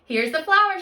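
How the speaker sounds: put-on loud, high call of a proud boy; excited shout. A narrator's reading. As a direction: shout it out with pride. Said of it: "shouted Jack".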